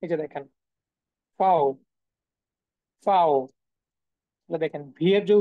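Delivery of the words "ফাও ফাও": ফাও as drawn-out "fow", starting with an "f"; German-style ফাও, rhyming with "cow"; German letter name ফাও. Shown as a demonstration. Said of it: "সেটাকে তারা বলে ফাও".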